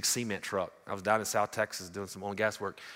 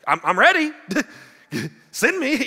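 loud, high, comically overwrought offer; mock-panicked volunteering; 13 dB louder than usual. Terrified man offering himself to God, mock-panicked volunteering. Mocks his own unready boast.

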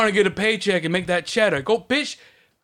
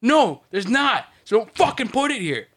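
loud, higher scolding rant; angry rant; 6 semitones higher, 3 dB louder than usual. Shown as a demonstration. Scolding a coworker about where skids go, angry and loud. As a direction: tear into him, fed up.

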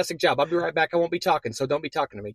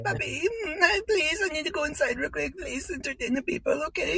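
high pleading voice; 9 semitones higher than usual. Pretend.

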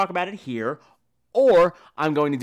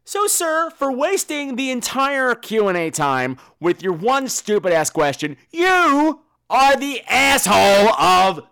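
loud, high, declaring a mock award; hammed-up award announcement; mock proclamation; 6 semitones higher, 6 dB louder than usual. Crowning the asshole of the week.

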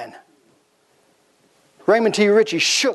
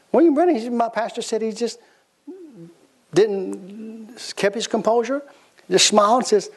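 usual speaking voice

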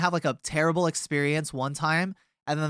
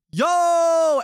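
sudden high, loud yell bursting in; drunk yell; 14 semitones higher, 8 dB louder than usual. Retold or imitated. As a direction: barge in yelling Yo, much higher and louder. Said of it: "he busts open the door and he goes".

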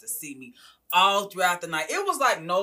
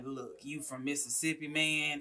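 quiet mentor; 2 semitones lower, 9 dB quieter, slower than usual. Retold or imitated. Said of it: "he was like".

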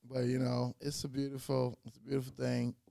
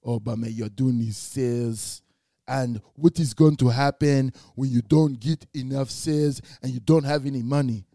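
imitating dad; 9 dB louder than usual. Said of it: "He's like".

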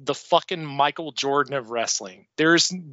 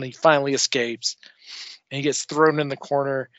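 ordinary talk

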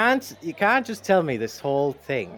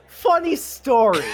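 drawn-out, higher and louder mock excuse; sheepish excuse; 8 semitones higher, 6 dB louder, slower than usual. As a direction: stretch it out as a loud, awkward stall. Acted excuse to a policeman.

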